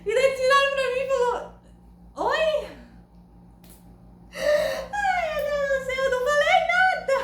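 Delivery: high, breathless laughter while telling the story; squealing with embarrassed laughter; laughing through it. Real, not acted. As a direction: laugh it out, almost in tears.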